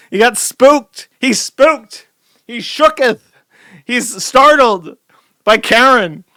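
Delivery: high, loud, hammed-up comic commentary; gleefully overdramatic recap; mock-dramatic narration. Hamming up the man's fright.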